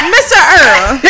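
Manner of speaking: called out with a laugh; calling out. Pretend. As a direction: call his name across the room. Calling out to Mister Earl.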